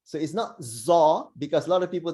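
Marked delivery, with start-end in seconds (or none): none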